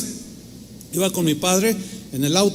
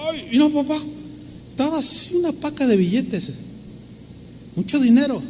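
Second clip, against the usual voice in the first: excited discovery; 6 semitones higher, 3 dB quieter than usual. Retold or imitated. Retells finding wallet full of money.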